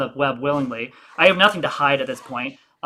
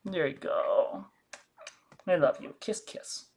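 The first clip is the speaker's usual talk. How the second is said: soft, slow whispered comfort; tender whisper; 12 dB quieter, slower than usual. Real, not acted. Soothing baby.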